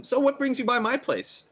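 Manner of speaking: excitable hostess voice. Acted. GM voices NPC hosting guest.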